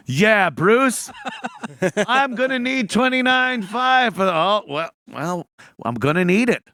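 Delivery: high, loud nervous demand; sheepish demand. Retold or imitated. Calling the GM to demand money, sheepish. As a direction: stammer through the ask. Retold awkward demand phone call.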